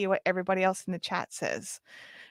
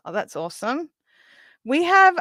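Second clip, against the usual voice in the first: ordinary delivery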